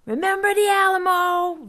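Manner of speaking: high loud charging cry; battle cry yell. A narrator's reading. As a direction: yell it while running down the trail. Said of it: "Vaco yelled".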